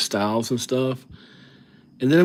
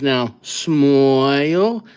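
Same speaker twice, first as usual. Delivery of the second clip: slow, drawn-out Brooklyn camera guy; Brooklyn accent; 3 semitones higher, 4 dB louder, much slower than usual. Retold or imitated. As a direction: stretch it like a New York photographer. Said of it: "he sounds like he's from ... Brooklyn or something".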